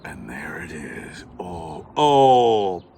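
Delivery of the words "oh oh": ordinary talk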